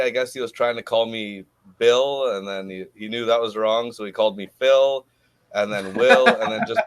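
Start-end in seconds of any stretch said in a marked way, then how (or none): 5.5-6.8 laughing through the name; a giggle breaking in. Real, not acted. Retelling funny nickname mix-up.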